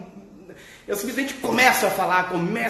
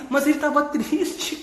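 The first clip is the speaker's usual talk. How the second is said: normal talking voice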